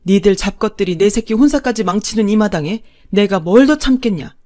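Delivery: loud, bitter tirade; furious mother-in-law. Acted. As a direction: unload years of anger. Mother-in-law finally explodes. (분노를 터뜨리는 시어머니)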